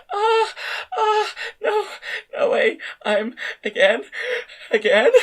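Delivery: very high, tearful woman's voice; lost in it, half crying; delirious high voice. A narrator's reading. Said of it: "as if she was becoming delirious".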